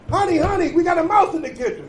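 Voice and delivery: high-pitched panicked wife; wife screaming. A narrator's reading. Wife calling husband about the mouse, high panicked cry. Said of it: "she screams".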